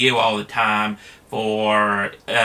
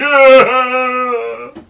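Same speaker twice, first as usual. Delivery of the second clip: high mock wailing laugh; 13 semitones higher, 8 dB louder than usual. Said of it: "like a spoiled child".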